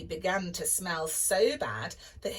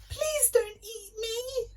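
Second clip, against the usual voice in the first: much higher frightened plea; pleading Jack. A narrator's reading. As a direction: beg not to be eaten. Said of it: "cried Jack".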